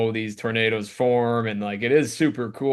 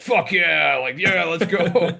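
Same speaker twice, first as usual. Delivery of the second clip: higher, louder excited cheer; hyped cheering; 6 semitones higher, 4 dB louder, slower than usual. Retold or imitated. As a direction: cheer like a thrilled bro. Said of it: "him and his buddies are just like".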